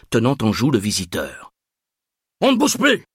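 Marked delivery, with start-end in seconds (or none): 2.4-3.0 very high yelled command. A narrator's reading. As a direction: yell it in sudden alarm. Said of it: "Alertés par les hurlements".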